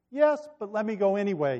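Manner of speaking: lightly acted pleading; begging. A narrator's reading. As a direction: beg with a touch of eagerness. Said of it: "he begged".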